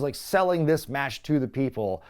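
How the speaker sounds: usual speaking voice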